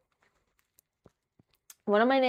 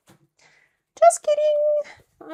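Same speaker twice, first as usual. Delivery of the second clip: very high, drawn-out, loud joke voice; silly high-pitched retraction; joking squeal; 6 dB louder, much slower than usual. Pretend. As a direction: stretch it way up high to take it back. Joking retraction after accent bit.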